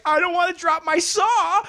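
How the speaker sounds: overacted ad fumble; cheesy actor whine. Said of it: "and he's like".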